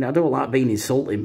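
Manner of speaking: ordinary talk, nothing marked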